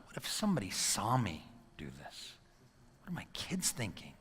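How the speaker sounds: hushed, near-whispered worry; sobered inner thought. Retold or imitated. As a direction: drop to a whisper as second thoughts hit.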